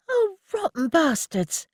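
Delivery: high, slow frustrated moan. A narrator's reading. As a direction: groan it out in disgust. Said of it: "Annie moaned".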